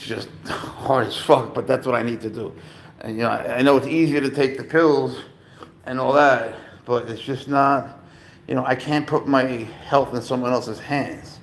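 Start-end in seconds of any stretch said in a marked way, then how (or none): none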